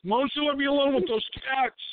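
high squeaky alien voice; Alf voice. Retold or imitated. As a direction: whine it like Alf. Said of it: "That's my elf impression".

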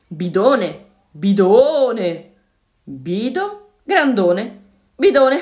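loud, resounding trash can voice; booming voice. A narrator's reading. Trash can looking into its belly and naming itself, booming. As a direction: boom it out, stretching the vowels. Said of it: "diceva con voce bombante".

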